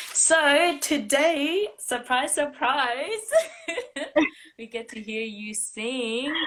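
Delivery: high, loud, drawn-out surprise reveal; excited announcement. Real, not acted. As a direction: tease the surprise with a laugh. Host announces surprise performance.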